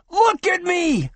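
man screaming hysterically in a flooded ward. Acted. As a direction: scream it like you've lost your mind. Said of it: "screamed the sandy-haired man".

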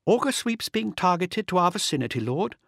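high-pitched female officer, composed report; quick but calm. A narrator's reading. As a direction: report briskly to your lord without alarm. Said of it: "her words quick but calm".

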